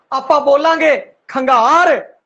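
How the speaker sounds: ਖੰਗਾਰ said slowly with a full voiced gh, no tone shift; ਖੰਗਾਰ drawn out, the gh kept breathy and even; full aspirated gh. Shown as a demonstration. Teacher to Punjabi students, demonstrating the full aspirated gh in ਖੰਗਾਰ. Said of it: "ਘੱਗਾ ਪੂਰਾ ਟਿਕਵਾ ਆ ਰਿਹਾ".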